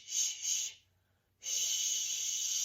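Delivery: drawn-out unvoiced sh; sh sound. Shown as a demonstration. Teacher models the sh sound for kids. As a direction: hiss out the sh sound. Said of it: "S H says sh".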